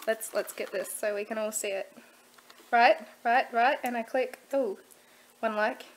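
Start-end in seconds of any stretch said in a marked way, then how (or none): 2.7-5.8 excited. Real, not acted. Watching the like appear live.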